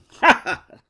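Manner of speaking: sharp loud evil laugh; villain laugh. Acted. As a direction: cackle like a soap villain. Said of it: "She just sounded like a telenovela villain".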